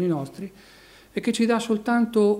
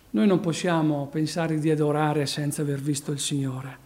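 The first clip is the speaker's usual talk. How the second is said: usual speaking voice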